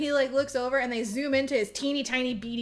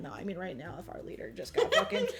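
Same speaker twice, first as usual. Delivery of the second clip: normal talking voice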